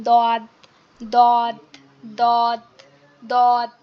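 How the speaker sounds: heavy dad sound from the tongue's back edge; Arabic letter dad. Shown as a demonstration. Teacher drilling দদ to the class, slow and deliberate. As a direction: press the tongue edge to the upper gums for দদ. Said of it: "সেটা হচ্ছে".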